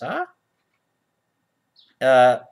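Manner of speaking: normal talking voice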